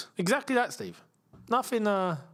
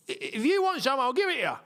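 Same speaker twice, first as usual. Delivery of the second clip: Wealdstone Raider; 6 semitones higher than usual. Retold or imitated.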